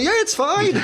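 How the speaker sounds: high, breezy mock shrug of a line; shrugging it off in a high voice; mock nonchalance. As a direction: toss it off high and unbothered, imitating the team.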